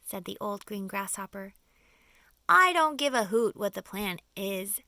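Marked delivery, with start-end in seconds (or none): 2.5-4.8 a loud stubborn outburst; cried out. A narrator's reading. Earthworm refusing to hear the plan, loud and stubborn. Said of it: "cried the earthworm".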